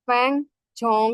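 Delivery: ফ্যাং ঝং with a clear ng ending; ফ্যাং said with ng, then ঝং; ফ্যাং ঝং, the better ng version; pronunciation demo. Shown as a demonstration. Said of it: "এরকম উচ্চারণটা better".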